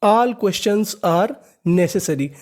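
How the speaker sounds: All questions are read very slowly, word by word. Shown as a demonstration. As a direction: spell the phrase out slowly for the class. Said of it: "तो पूरा sentence देखते हैं". (धीमे-धीमे पढ़ना)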